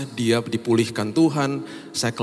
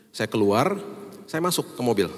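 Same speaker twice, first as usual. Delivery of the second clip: ordinary talk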